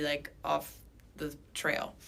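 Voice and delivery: ordinary talk, nothing marked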